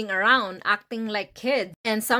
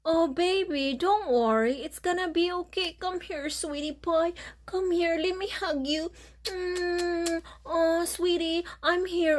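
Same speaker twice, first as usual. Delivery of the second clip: tender comforting; 7 semitones higher than usual. Shown as a demonstration. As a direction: hold and soothe her gently. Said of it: "this is what you have to do".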